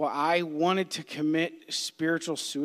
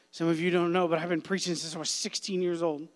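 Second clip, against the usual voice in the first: ordinary talk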